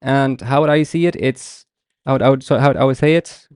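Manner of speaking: ordinary talk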